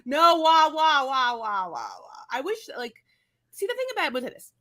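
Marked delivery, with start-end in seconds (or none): 0.0-1.9 a big shrieking laugh, then mock wah-wah crying that fades out; hammed-up cartoon crying that trails off; mock wailing.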